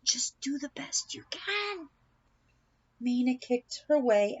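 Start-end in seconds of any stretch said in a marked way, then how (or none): 0.0-1.9 whispered encouragement. Acted. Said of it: "said Missus Jackson".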